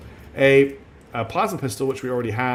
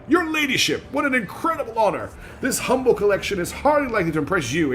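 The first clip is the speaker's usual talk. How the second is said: put-on high-pitched gushing young man; ringing voice; 8 semitones higher than usual. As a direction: fawn over nobility, pitched way up. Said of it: "his voice ringing".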